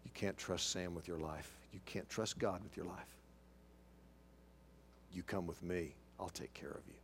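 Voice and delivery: low, soft, lightly voiced tempter; a hint of a quiet, low inner voice; voice in her head. Tormenting voice in wife's head luring her, low and quiet. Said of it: "I've heard this voice in my head".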